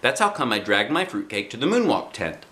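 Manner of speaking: ordinary delivery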